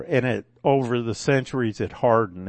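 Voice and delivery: ordinary talk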